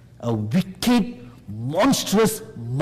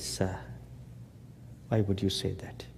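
low, much softer and timid; sheepish reply to Moses, low and much softer; sheepish little boy; 7 semitones lower, 12 dB quieter than usual. Retold or imitated. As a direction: shrink like a boy before his father. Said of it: "very sheepishly, like a little boy before a father".

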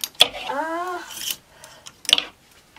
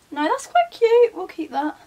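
delighted change of mind; 10 semitones higher, 7 dB louder than usual. Real, not acted. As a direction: gush over it. Changing her mind about an item.

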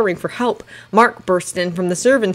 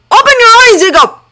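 high, loud desperate yell; 11 dB louder than usual. A narrator's reading. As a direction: yell to break through his pain. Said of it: "and Mark yelled".

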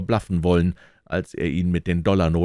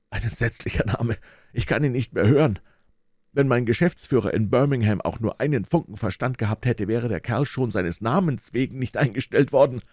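high, shaken and indignant; a banker reeling from the news; shocked banker; 6 semitones higher than usual. A narrator's reading. (erschütterter, empörter Bankier)

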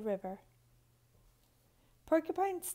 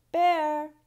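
loud, higher call toward the cave; 5 semitones higher, 9 dB louder than usual. A narrator's reading. Porcupine calling into Bear's cave, loud higher call. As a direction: shout his name into the cave. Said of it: "she called".

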